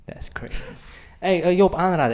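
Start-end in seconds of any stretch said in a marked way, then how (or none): 0.0-0.6 soft whispered aside; whispering. Real, not acted. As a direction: whisper it to yourself. Whispered aside after disgust.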